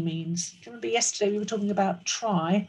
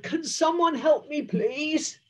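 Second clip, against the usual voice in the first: lightly roared, higher plea; roaring lion; 7 semitones higher than usual. Acted. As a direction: cry for help as the poorly lion. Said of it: "the lion roared".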